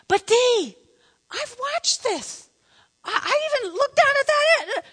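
very high mock protest; comic high-pitched husband impression; pleading husband. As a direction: squeak out his desperate case.

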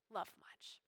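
unvoiced, hushed whisper. Real, not acted. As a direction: let it fall to a breath. Climax line whispered.

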